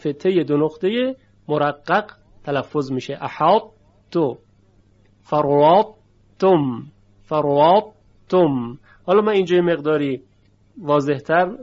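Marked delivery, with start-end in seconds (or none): 3.2-4.4 احاط تو with a thick, emphatic ط and a light ت; exaggerated heavy ta. Shown as a demonstration. Teacher exaggerating احاط تو to contrast heavy and light. 5.2-8.8 فروات تم loud, with the ط made very thick; exaggerated heavy ta. Shown as a demonstration. Teacher exaggerating فروات تم for clarity.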